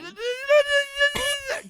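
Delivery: loud, very high tongue-waggling bleat; short burst of mock llama noise. Showing the moving tongue for a llama, loud silly bleat. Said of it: "Don't look at me while I do this".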